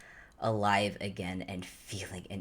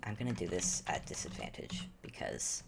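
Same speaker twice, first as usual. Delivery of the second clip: whispered aside; 3 semitones lower, 6 dB quieter than usual. Real, not acted. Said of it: "whispered aside about the roll".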